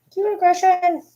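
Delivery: very high squeal of affection; cute aggression. Real, not acted. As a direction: squeal it like you could squeeze the dog.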